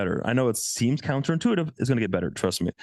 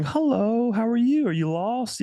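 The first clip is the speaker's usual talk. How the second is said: high, teasing singsong greeting; giggly women; 13 semitones higher than usual. Retold or imitated. Women in the club teasing him, in a high, giggly voice. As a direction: mimic the amused women, pitched up. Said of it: "started giggling and laughing".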